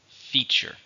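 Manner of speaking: "Feature" stressed on FEA, light second syllable; stress on first syllable. Shown as a demonstration. Teacher models the word for learners, stressing FEA in "Feature". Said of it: "Stress is on the first syllable".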